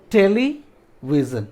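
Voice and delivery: टेलीविजन slowly, with a pause after टेली; syllable breakdown. Shown as a demonstration. Teacher introducing a three-syllable word, slowly broken up. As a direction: split टेलीविजन: टेली ... विजन. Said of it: "इसको break कर देते हैं".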